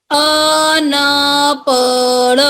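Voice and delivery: अनपढ़ drawn out in a sing-song; sing-song slow reading. Shown as a demonstration. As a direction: chant अनपढ़ slowly for the class. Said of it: "तीन वाले शब्द देखते हैं". (अनपढ़ गाते हुए धीरे से)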